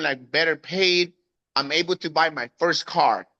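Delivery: ordinary talk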